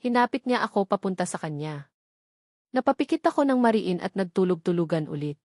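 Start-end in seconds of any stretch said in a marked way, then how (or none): none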